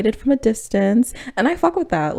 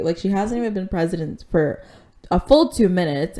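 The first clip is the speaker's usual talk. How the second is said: ordinary talk, nothing marked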